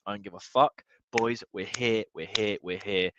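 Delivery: ordinary delivery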